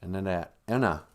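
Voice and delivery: usual speaking voice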